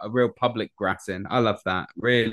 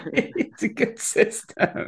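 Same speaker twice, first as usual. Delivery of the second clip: slow, breathy mock whisper, higher; hushed, slow mock aside, pitched up; 10 semitones higher, much slower than usual. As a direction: whisper it slowly like a sly schemer. Mock voice about the fork man.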